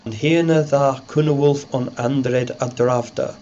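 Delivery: put-on reading the Old English text aloud. Shown as a demonstration. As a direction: recite it in Old English pronunciation. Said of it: "The text".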